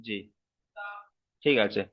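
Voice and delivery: usual speaking voice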